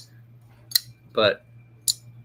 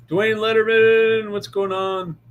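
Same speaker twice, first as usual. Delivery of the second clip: high, loud, slow greeting; cheery greeting; 10 semitones higher, 5 dB louder than usual. Real, not acted. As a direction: sing out the viewer's name. Greeting chat viewer by name.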